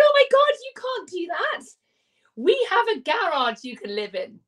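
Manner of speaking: high, shocked gasp of disbelief; shocked client. Retold or imitated. Client hears she lives in her car, shocked. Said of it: "I just went".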